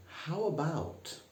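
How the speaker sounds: a hint of a prim office manager asking; mock-refined boardroom suggestion; posh boardroom speak. Shown as a demonstration. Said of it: "don't say".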